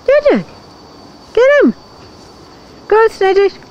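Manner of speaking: high, eager cheer; excited urging. Real, not acted. Owner cheering pets on. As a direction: egg the dogs on.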